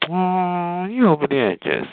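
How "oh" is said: ordinary talk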